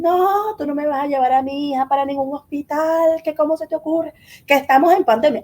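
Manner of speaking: husband's high-pitched outraged outburst, imitated; retold shouted protest. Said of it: "Pegaba gritos, fue su reacción".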